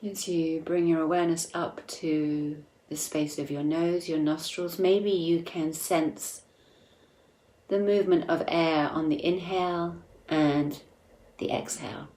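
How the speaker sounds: soft, unhurried meditation voice with long pauses; guided meditation. Acted. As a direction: guide listeners inward gently, leaving space between phrases.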